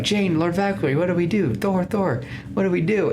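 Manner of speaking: high, mock-urgent pleading; imitated anxious crowd. Asgardians run to Jane for orders, imitated anxious pleading.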